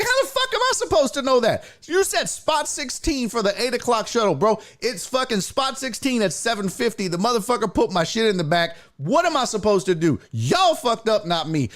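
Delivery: high-pitched, incredulous retold complaint; outraged rant. Customer blames supervisor over shuttle mix-up, outraged. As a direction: protest hard that it's their fault.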